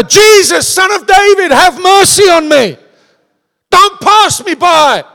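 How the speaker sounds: high, loud, drawn-out plea. Acted. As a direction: cry out over everyone trying to silence you. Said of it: "He shouted even louder".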